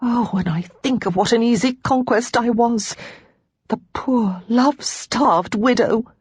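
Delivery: tearful. Acted. Lara sobbing confession.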